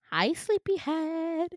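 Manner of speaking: high, slow sing-song hello; cutesy nurse greeting. Retold or imitated. Nurse greeting waking patient, high cutesy sing-song.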